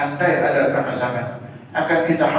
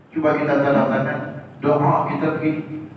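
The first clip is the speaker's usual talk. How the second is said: ordinary delivery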